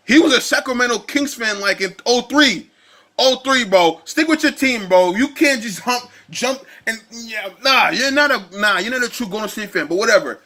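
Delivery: usual speaking voice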